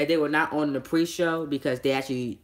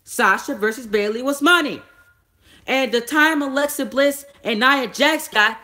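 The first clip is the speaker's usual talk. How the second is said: excited rant; 9 semitones higher, 6 dB louder than usual. Real, not acted. Ranting about the booking choice.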